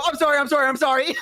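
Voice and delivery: high-pitched mock-panicked apologies; frantic apology. Child to papa after throwing snake, imitated frantic apology. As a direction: squeak out repeated apologies like a caught child.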